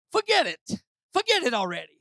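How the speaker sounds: lightly put-on New Jersey talk, dropping at the end; New Jersey accent. Shown as a demonstration. Preacher joking about local vernacular, in a New Jersey accent. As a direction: toss it off like someone from New Jersey. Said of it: "Maybe New Jersey".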